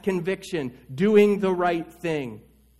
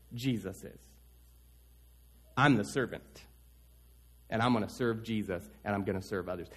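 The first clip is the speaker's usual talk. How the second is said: soft, lower, hushed pledge to serve; quiet sincere vow; 8 semitones lower, 8 dB quieter than usual. Pretend. Believer quietly vowing to serve Jesus and others, hushed and sincere. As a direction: murmur it like a quiet promise.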